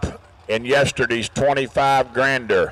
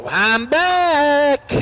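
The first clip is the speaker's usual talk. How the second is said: I'm back called out much higher; high excited; 11 semitones higher than usual. Real, not acted. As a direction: belt it out high and happy. Commentator returns to the mic.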